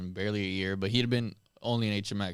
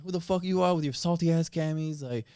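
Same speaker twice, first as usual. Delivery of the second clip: imitated sergeant's scolding, pitched up; chewing out; 6 semitones higher than usual. Sergeant berating a Marine over salty camis, mock chewing-out. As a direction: scold like an indignant sergeant, in retelling. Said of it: "he like, chews me out".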